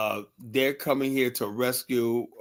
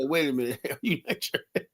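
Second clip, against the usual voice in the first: amused disbelief; 2 semitones higher, 4 dB quieter than usual. Retold or imitated. Retold surprised question to mechanic.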